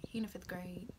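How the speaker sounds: whispered softly, like a secret; quiet confiding whisper; whispered reveal. Retold or imitated. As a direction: breathe out the news. Friend whispering the news.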